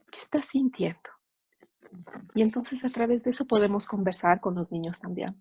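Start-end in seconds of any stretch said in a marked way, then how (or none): 0.1-1.1 soft whisper to yourself; whispered question. Retold or imitated. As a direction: whisper the question gently, as if asking inwardly. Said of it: "preguntarse eso".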